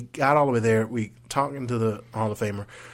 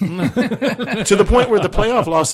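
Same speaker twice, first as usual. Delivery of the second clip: laughing; 11 semitones higher, 10 dB louder, much slower than usual. Real, not acted. Laughing about the curse.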